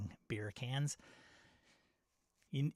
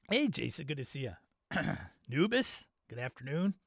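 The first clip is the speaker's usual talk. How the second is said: usual speaking voice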